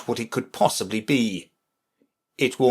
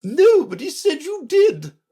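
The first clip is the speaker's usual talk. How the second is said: very high, louder queen voice; retorting Red Queen; 5 dB louder than usual. Acted. Red Queen answering Alice's objection, sharp high retort.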